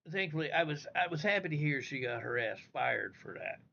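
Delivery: ordinary talk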